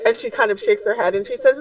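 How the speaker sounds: ordinary delivery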